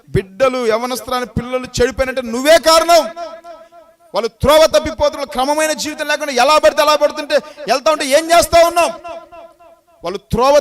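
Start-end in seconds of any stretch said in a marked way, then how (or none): 0.1-8.9 loud, high rebuke from the pulpit; fiery sermon scolding; preaching at full force. Acted. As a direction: hammer the blame onto the parents. Preacher rebukes parents loudly.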